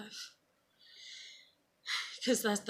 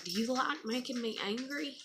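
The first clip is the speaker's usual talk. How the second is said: higher mock stern scold; 6 semitones higher than usual. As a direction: scold the child sent to timeout. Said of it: "And I was like".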